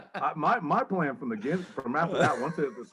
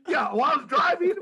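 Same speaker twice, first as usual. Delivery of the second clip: ordinary talk, nothing marked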